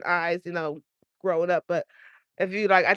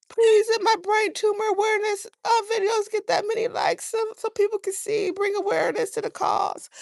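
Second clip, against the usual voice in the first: high, pleading mock prayer to the internet; 12 semitones higher than usual. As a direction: beg in a high voice for likes. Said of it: "I was like".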